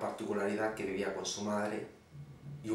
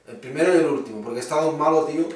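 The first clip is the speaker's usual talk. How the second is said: loud, higher indignant comeback; loud retort; 5 semitones higher, 11 dB louder, faster than usual. Acted. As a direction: blurt out the punchline loudly at the waiter. Señor replica al camarero extrañado.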